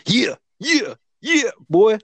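put-on high, hyped-up yeah boy; hype voice. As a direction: cheer it like a hype man. Hype reply to host intro.

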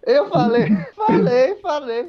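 high, laughing while repeating falei. Real, not acted. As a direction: crack up as you admit it. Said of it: "Você mandou essa". (rindo alto e agudo, repetindo falei)

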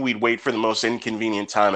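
normal talking voice

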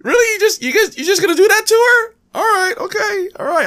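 high-pitched, incredulous mock indignation; mock outrage. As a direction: squeal it in comic disbelief, as if wronged. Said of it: "it was just so mad. I was so mad".

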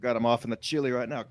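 ordinary talk

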